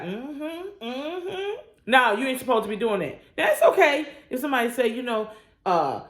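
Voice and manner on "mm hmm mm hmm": soft, high knowing mm-hmm; knowing agreement. Retold or imitated. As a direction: hum along slyly in agreement. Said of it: "you be like".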